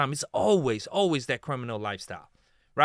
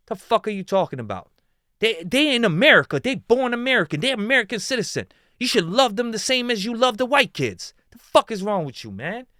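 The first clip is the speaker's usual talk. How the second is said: higher and louder, indignant; furious rant; 7 semitones higher, 5 dB louder than usual. Real, not acted. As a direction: let the outrage spill over.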